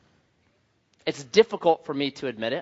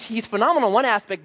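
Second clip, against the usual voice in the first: ordinary talk, nothing marked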